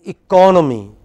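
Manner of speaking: firm teacher's demo of eCONomy; stress on second syllable. Shown as a demonstration. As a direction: model "economy" clearly, punching the CON. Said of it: "but listen to me".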